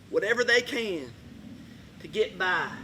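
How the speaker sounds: normal talking voice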